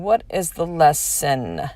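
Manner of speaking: slow, drawn-out "What is the lesson?"; slow repeat. Real, not acted. Mother asking herself again about her kids, slowly. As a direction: stretch out "What is the lesson?" deliberately.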